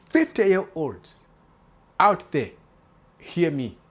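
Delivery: reach out and grab the fifty-year-olds' attention; calling out. Real, not acted.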